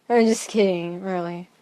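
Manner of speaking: ordinary delivery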